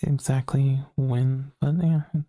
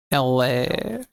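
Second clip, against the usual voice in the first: drawn-out California-accented "L A". Shown as a demonstration. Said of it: "you don't have the California accent".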